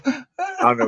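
put-on fast, laughing Massachusetts accent. Co-host teasing a chat commenter, in a laughing Massachusetts accent. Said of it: "the Massachusetts voice".

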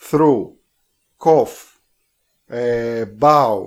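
through, cough, bow each said slowly and loudly as separate English words; English spelling examples. Shown as a demonstration. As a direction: set out each English word on its own, loudly, as a pronunciation example. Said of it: "γράφονται το ίδιο και προφέρονται όλες διαφορετικά".

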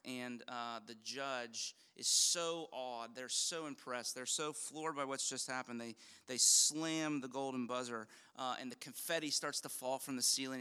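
usual speaking voice

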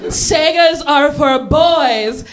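slow sing-song prim pronouncement; prim refusal. Retold or imitated. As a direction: declare it primly, drawn out. Bossy kid refusing.

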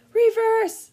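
very high, very loud yell; yelled command. Acted. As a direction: scream the order to the car. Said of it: "Harry yelled".

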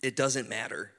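slow, hushed praying voice. Acted. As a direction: pray it out gently, slowly. Leads a prayer over music.

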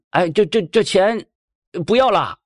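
stammering loud ask to nobody; loud question. Acted. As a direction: call out to the empty counter. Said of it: "就大声问了".

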